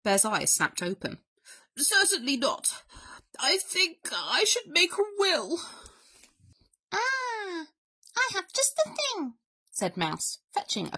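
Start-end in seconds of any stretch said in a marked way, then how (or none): 1.8-5.6 high, melodramatic invalid bear; self-pitying, hammed-up bear; huffing bear. A narrator's reading. Bear declares himself dying. 6.9-9.3 very high little mouse voice; chirpy storybook mouse; too-cheerful mouse. A narrator's reading. Mouse offers help.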